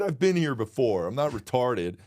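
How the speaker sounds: ordinary talk, nothing marked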